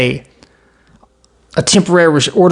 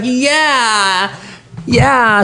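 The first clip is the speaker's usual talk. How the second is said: mock crowd cheer; 7 semitones higher than usual.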